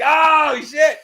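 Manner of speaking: loud, high shocked outburst; shocked oh shit. Retold or imitated. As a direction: blurt it out in shock. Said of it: "I'm like".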